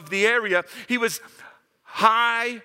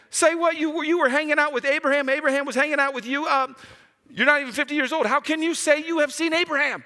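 outraged disbelief; 9 semitones higher than usual. Shown as a demonstration. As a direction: hurl the question back in outrage. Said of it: "You need to put some inflection in it".

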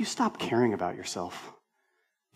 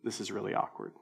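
awkward murmur; 4 semitones lower, 10 dB quieter, faster than usual. Retold or imitated. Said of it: "Him and I were both like".

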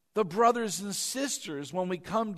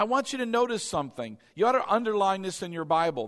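ordinary talk, nothing marked

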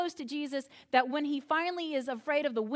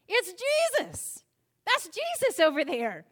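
much higher, slow, amazed; awed recognition; 5 semitones higher, 4 dB louder, slower than usual. Retold or imitated. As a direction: let it dawn with wonder. Beloved disciple has the epiphany.

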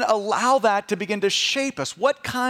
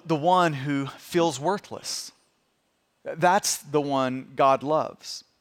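tender, solemn preaching; 5 semitones lower than usual. Real, not acted. Pastor tells the congregation God loves the frail, tender and solemn. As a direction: let it sink in gently, low and unhurried.

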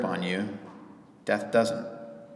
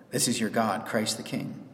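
ordinary talk, nothing marked

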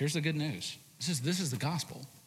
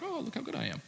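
higher, airy mock boast; smug self-praise; 12 semitones higher, 4 dB quieter, faster than usual. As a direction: preen like you're proud of yourself. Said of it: "you're like".

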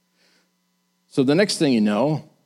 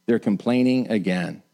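ordinary talk, nothing marked

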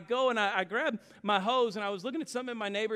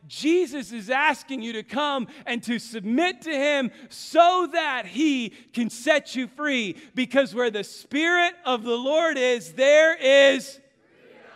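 louder and higher, building to a shouted freedom; preaching at full force; 6 semitones higher, 8 dB louder than usual. Acted. Pastor calling the congregation into freedom, climbing to a shout. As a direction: lift the room to the final word. Sermon climax.

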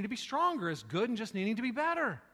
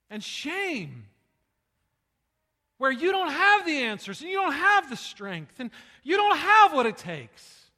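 slow, loud, swelling preacher's cry; preaching at full force; 7 semitones higher, 12 dB louder, slower than usual. Acted. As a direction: lean on every word as it climbs. Preaching build.

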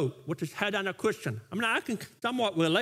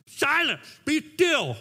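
higher, louder mock order to the kids; imitated parental command; 7 semitones higher, 5 dB louder, slower than usual. Dad trying it on his kids, imitated command. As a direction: demonstrate a dad's command, run straight through. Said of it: "I try to do that for my kids".

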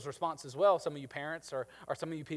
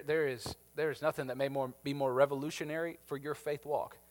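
ordinary talk, nothing marked

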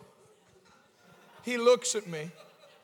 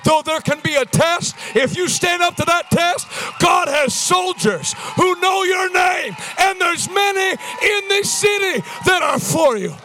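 raised, loud revival preacher delivery; full-force preaching; 8 semitones higher, 10 dB louder than usual. Real, not acted. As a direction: drive it home to the congregation.

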